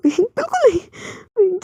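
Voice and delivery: high, slow embarrassed protest; high-pitched, drawn-out embarrassed no; flustered refusal. Acted. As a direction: squeal it out, mortified.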